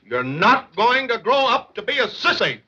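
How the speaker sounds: slow, high, emphatic rebuke; exasperated scolding. Acted. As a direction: lay down the law word by word. Exasperated father scolds his son.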